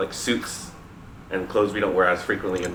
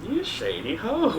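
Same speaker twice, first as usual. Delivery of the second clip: playful teasing; 13 semitones higher, 4 dB quieter, slower than usual. Real, not acted. Teasing friend's comment.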